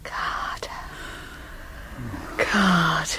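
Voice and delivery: low breathy whisper. Acted. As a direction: sigh his name in a whisper. Whispered intimacy.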